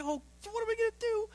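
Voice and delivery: high-pitched, rushed parent panic; mock freaking out. Parent over a child's ear infection, mock freaking out. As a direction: imitate your own overblown worry, voice shooting up. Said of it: "but I'm freaking out".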